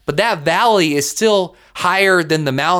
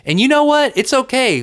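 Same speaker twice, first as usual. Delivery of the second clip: excited lift; 9 semitones higher than usual. Real, not acted. Podcast host encourages listeners.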